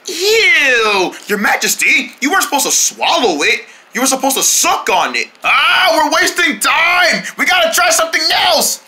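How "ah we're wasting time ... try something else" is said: frantic urging. Acted. Impatient helper urging the group.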